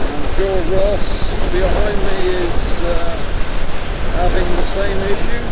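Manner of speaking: muffled through a helmet mic; riding with wind noise; inside a helmet. Real, not acted. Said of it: "I hope there's not too much wind noise going into the microphone".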